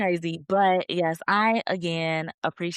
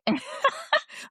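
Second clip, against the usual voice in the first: high-pitched laugh. Real, not acted. Host amused by her own reply, laughing.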